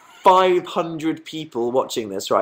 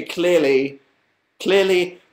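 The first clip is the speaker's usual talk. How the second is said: ordinary delivery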